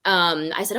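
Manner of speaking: ordinary talk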